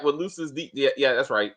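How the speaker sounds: ordinary delivery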